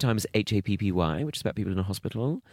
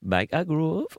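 higher, put-on Geordie vowels; Geordie accent; 7 semitones higher, much slower than usual. Shown as a demonstration. Comedian repeating the show title in a mock Geordie accent. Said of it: "That was my Geordie accent".

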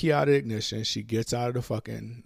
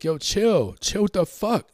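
high, louder mock-distressed plea; pleading, raised-pitch imitation of being upset; retold screaming, crying; 9 semitones higher, 5 dB louder than usual. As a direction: retell a panicked yell without really screaming. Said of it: "I'm screaming and crying at this time".

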